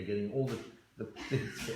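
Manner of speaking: ordinary delivery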